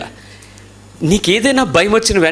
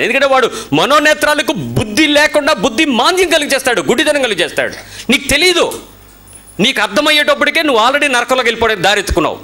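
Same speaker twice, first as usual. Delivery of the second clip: fiery preaching; 8 semitones higher than usual. Acted. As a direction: thunder the warning from the pulpit. Preaching at full force. (ఉద్వేగంగా హెచ్చరించే ప్రసంగం)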